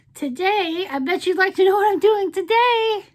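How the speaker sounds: high, loud playful teasing; excited tease. Real, not acted. Vlogger teasing her viewers about today, excited. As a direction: draw the viewers in with a bright hook.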